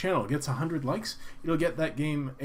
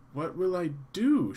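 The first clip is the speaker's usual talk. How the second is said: worried woman; 5 semitones higher, 4 dB louder than usual. Acted. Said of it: "she continues".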